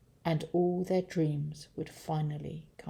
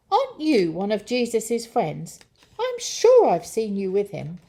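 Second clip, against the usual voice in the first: put-on higher, louder accusing servant girl; pointed, raised-voice accusation; 4 semitones higher, 6 dB louder than usual. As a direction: challenge him, sure you recognise him.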